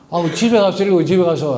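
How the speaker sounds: loud, high, scolding outburst; mock angry yell. Library patron yelling at the reading mother, loud and angry. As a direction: snap at her to read it at home.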